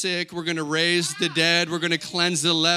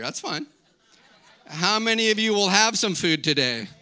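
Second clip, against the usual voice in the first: usual speaking voice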